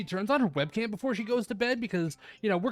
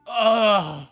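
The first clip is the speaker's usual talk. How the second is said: loud, drawn-out groan of annoyance; annoyed groan; 10 dB louder than usual. Acted. Boy caught in his pajamas by his friend, loud annoyed groan. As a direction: groan it out, heavy and fed up. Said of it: "I let out a groan of annoyance".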